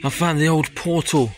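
normal talking voice

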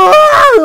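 sudden high yell of alarm; panicked scream. Real, not acted. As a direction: scream in fright. Shout while chased. (panik çığlığı)

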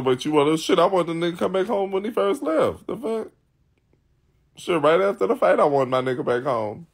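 usual speaking voice

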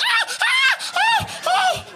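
hammed-up squealing moans, loud and high; mock moans, very high. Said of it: "they be like".

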